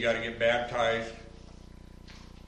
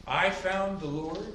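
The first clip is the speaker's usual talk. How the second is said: higher, slow, smug believer; mock boast; 8 semitones higher, 4 dB louder, slower than usual. Pastor mimicking a self-credited convert, higher and smug. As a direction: lightly mimic someone claiming credit. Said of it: "Wouldn't it be".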